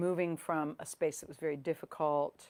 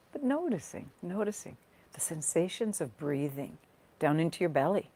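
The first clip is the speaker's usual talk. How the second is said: guided meditation. Real, not acted. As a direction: gently guide listeners into their breath, leaving space between phrases. Leads a guided body scan.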